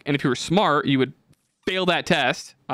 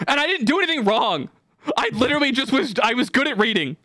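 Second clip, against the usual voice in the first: venting to friends about losing recess, fuming; 9 semitones higher than usual. Real, not acted. Pitched up, outraged. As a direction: voice climbing high in real indignation. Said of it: "I'm, like, fucking fuming right now".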